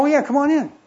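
high, bright mock welcome from the players; cheerful invitation. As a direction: call them in with a cheery lift. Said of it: "They go".

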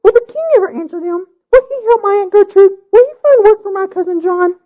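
high, urgent, pleading bride. A narrator's reading. Bride demanding answers.

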